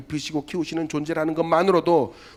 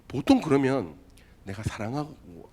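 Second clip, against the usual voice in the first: ordinary delivery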